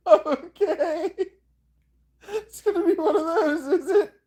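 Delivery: very high voice through laughter; laughing squeak. Real, not acted.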